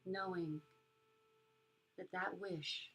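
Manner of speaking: soft spa whisper. Acted. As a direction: guide them gently into calm. Leads a metta meditation.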